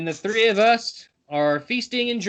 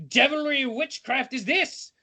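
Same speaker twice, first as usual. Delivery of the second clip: high, rising theatrical question; hammed-up astonished guard; aghast exclamation; 8 semitones higher than usual. A narrator's reading. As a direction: exclaim it in horrified disbelief. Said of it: "he exclaims".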